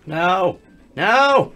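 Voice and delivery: high, loud "no", building; alarmed shout. Real, not acted. Player reacting to a bad play, alarmed shouting.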